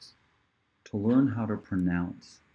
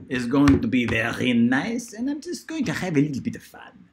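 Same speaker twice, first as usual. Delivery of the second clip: playful put-on French accent, lilting up and down; mock-French teacher voice; 5 dB louder than usual. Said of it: "that is a bit of a French accent".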